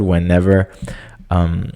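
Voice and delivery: ordinary delivery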